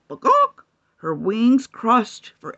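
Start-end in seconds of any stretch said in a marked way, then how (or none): 0.1-0.6 high squawking bawk; excited cluck. Acted. Chicken clucking excitedly into the circle. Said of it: "with an excited cluck".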